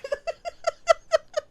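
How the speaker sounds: high, wheezing shocked laugh; shocked laughter. Real, not acted. Shocked laugh after the sniff.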